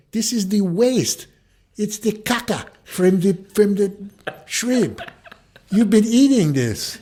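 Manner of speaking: high, loud, laughing mock French guy. French guy lectures Chuck about shrimp, high laughing mock voice. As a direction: ham up the Frenchman's disgusted lecture.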